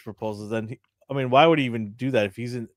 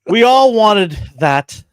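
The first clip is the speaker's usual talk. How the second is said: loud emphasis; 10 semitones higher, 11 dB louder, much slower than usual. Real, not acted. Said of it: "repeated, first loud and slow".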